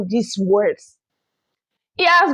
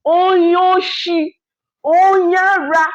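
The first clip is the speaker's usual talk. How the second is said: slow, raised call, each word stretched; drawn-out exclamation; 5 semitones higher, 4 dB louder, slower than usual. Real, not acted. Host calls out over another brother from the fatherland, drawn-out exclamation. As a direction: cry each word out long and high. Lamenting call about a fellow countryman.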